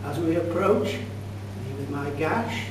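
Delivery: ordinary talk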